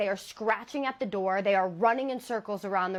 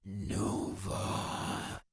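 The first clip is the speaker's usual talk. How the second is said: much lower, softer unvoiced demonic rasp; 13 semitones lower, 6 dB quieter, much slower than usual. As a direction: growl the name like a demon. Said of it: "it sounds almost demonic".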